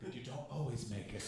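ordinary talk

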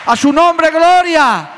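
very high, loud cry of glory; climactic praise shout. Real, not acted. Preacher crowning his sermon with a high, loud shout.

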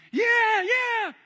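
high, excited crowd cheer; the crowd eagerly shouting yeah back to the walker. Acted. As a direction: yell yes like a thrilled crowd.

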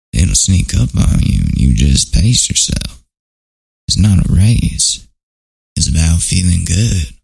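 Matthew McConaughey impression. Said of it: "It sounds like a Matthew McConaughey".

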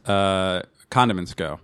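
normal talking voice

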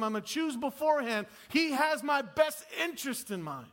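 loud, high preaching push; full-force preaching. Acted. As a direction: drive it home to the congregation.

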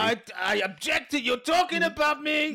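put-on high, hammy Russian villain accent. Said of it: "Now you're Moriarty".

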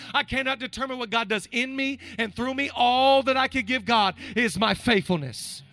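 preacher stirring the congregation toward faithfulness; rhythmic sermon litany. Acted. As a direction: hammer each line of the list. Preaching at full force.

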